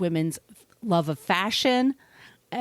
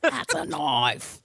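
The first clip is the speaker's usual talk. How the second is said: slow, lower Australian drawl quoting Crocodile Dundee; Crocodile Dundee accent; 6 semitones lower, slower than usual. Retold or imitated. Host answers cohost with a slow Australian Crocodile Dundee line. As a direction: deliver it like a lightly done Aussie Crocodile Dundee. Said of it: "sounds like Crocodile Dundee".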